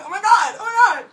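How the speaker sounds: high, loud giddy squeal; loud high fangirl squeal. Retold or imitated. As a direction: squeal like a smitten eighth grader. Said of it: "I was like".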